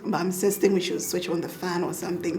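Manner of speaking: ordinary talk, nothing marked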